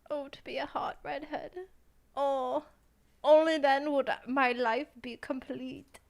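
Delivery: loud, slow, hammed-up German accent; over-the-top German accent, slow and loud; mock German accent. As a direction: declaim it like a dramatic German. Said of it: "that made me German".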